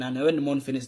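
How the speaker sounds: normal talking voice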